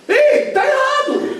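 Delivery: slow, high, loud call with a laugh; indignant protest. Acted. Boy in the pew objects to the preacher, loud and indignant.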